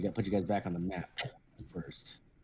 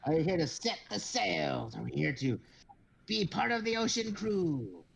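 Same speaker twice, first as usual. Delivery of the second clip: higher, self-important little captain; pompous kobold captain; 6 semitones higher than usual. Acted. Kobold captain boasting to the crew, high and pompous. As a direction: puff up like a tiny captain in a big hat.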